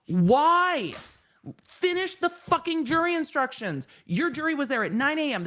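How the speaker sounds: high, exasperated outburst; mad. Real, not acted. Streamer pleading with the judge to finish jury instructions, mad. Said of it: "because I'm mad".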